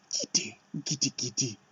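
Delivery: whispered urging. Real, not acted.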